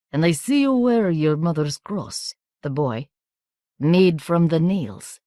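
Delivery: a hint of a slow Romanian accent; measured, knowing foreign boy; foreign boy, lightly voiced. A narrator's reading. Markasev telling Miranda he knows her family, lightly accented.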